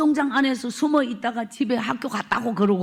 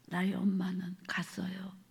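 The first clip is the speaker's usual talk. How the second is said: much softer, lower, breathless whisper; grief-stricken whisper; 5 semitones lower, 11 dB quieter than usual. Real, not acted. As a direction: let the words fall away in a whisper. Telling of mother's departure.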